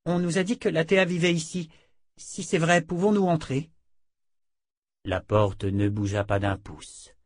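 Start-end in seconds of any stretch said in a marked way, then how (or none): 0.1-3.7 put-on higher voice of a young visitor; polite young woman. A narrator's reading. Jensen asks the sorceress to let them in, polite request.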